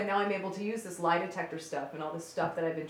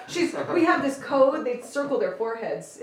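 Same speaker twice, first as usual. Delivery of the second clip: normal talking voice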